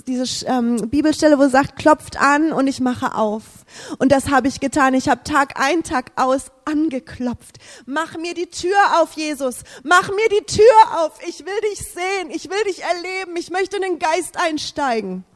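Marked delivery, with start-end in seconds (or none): none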